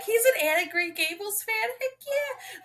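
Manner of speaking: very high, gleeful cheer; excited fangirling. Retold or imitated. Reader delighted the hero likes Anne of Green Gables. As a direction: squeal with delight. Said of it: "I was like".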